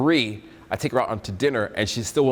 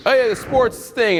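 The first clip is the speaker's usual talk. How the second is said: much higher, slow mock awkward voice; awkward nervous self; 12 semitones higher, 3 dB louder, slower than usual. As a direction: play your younger self, lost and unsure. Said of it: "I'd be like".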